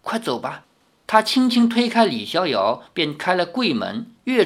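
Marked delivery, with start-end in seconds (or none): 0.0-0.6 softly, barely above a murmur; low voice. A narrator's reading. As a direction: hush it out, urging him to go. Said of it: "只低声说". (低声催促)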